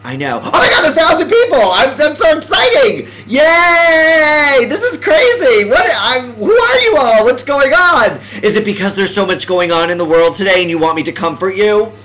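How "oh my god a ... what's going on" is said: high-pitched, thrilled squeal of words; excited disbelief. Real, not acted. Streamer greets a thousand viewers, excited disbelief. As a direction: shriek it, overjoyed and amazed.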